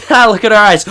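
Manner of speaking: loud, high-pitched burst of hilarity; tripping excitement. Retold or imitated. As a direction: act out giddy friends cracking up, very loud. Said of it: "this is what we act like".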